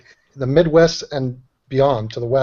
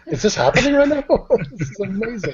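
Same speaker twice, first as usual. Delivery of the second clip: laughing disbelief; 7 semitones higher than usual. Retold or imitated. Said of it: "I was like".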